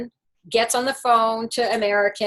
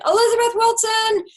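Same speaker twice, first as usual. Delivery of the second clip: high, loud name call across the line; retold yell; 11 semitones higher, 5 dB louder than usual. Stewardess calling the late passenger by name, high yell.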